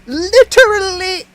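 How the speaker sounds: sarcastic outburst. Real, not acted. Streamer scolding Shiro's reply, loud slow sarcasm. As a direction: spell out his stupidity with scorn. Mocking response to hero.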